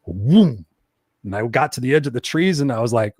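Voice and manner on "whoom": a loud rushing whoom; whoosh sound effect. Retold or imitated. Mimicking the push off the ledge, a loud whoosh. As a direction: make the zip line launch sound. Imitating zip line launch.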